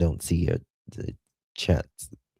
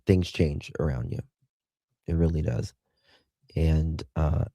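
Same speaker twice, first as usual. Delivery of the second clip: ordinary talk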